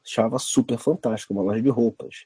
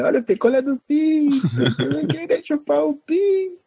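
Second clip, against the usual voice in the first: singsong beach vendor call. Retold or imitated. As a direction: cry it out like a popsicle seller. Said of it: "tinha o cara que vendia picolé".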